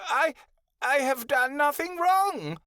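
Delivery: whimpering. A narrator's reading. Malachi whimpering before the Great One. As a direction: whimper in confusion and fear. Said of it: "Malachi whimpered".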